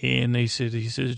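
ordinary delivery

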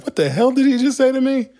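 high-pitched, loud disbelief; flabbergasted. Retold or imitated. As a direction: re-enact a totally baffled newcomer. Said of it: "And I'm flabbergasted".

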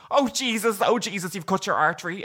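high, panicky retold exclamation; imitated alarm. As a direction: act out the doctor's shock, high-pitched. Doctor's alarm acted out.

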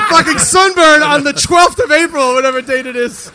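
high, very loud comic disbelief; incredulous rant. Real, not acted. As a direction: yell it in exasperated amazement. Comic rant at Ken's sunburn.

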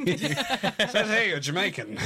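slow laughing shout of a mock clerk; laughing mock official. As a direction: read it out like a clerk, cracking up.

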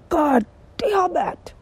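very high, very slow, groaning God damn it; exasperated. Real, not acted. Fed up with unpaid asks, a drawn-out exasperated cry. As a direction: let it all out in one exasperated groan.

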